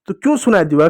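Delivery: usual speaking voice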